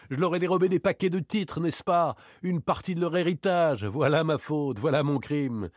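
high-pitched mock confession; sarcastic confession. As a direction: reel off your crime with scornful irony. (faux aveu sarcastique)

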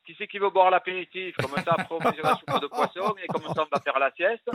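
réplique caricaturale, voix haut perchée et plus forte; Marseillais de caricature. Acted. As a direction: joue le Marseillais de cliché, plus aigu. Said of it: "j'ai toujours un pantalon jaune". (Marseillais de caricature, voix montée dans les aigus)

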